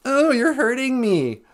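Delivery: high, drawn-out mock whine; mock bellyaching. Comic mimicking complainers from scripture, slow high whine. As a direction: stretch out a hammed-up, wounded complaint. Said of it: "That's my impression of".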